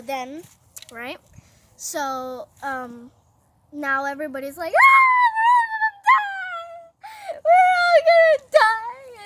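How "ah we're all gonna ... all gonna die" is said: very high, loud drawn-out wail; hammed-up mass panic. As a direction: wail like a doomed crowd, mock terror. Everybody panics at decree.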